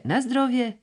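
high, squawking bird cry on "na zdrowie"; parrot-like bird call; starling squawk. A narrator's reading. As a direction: screech "na zdrowie" again like a caged starling. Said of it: "szpak w klatce ... krzyczał".

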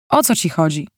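plain reading voice

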